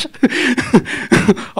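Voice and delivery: high, breathless real laugh; amused laugh. Real, not acted. Film star relieved by a friendly question, laughing. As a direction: keep laughing after the thanks. Amused after thanking the reporter.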